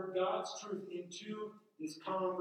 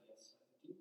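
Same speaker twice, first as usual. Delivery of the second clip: whispered yes; 5 semitones lower, 20 dB quieter than usual. Pretend. Paul's eager whispered reply.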